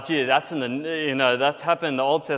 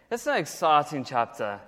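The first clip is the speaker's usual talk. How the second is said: usual speaking voice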